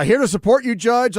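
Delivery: high, loud, eager reading; enthusiastic read. Shown as a demonstration. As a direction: read it out with gusto. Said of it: "reading listener message, first take".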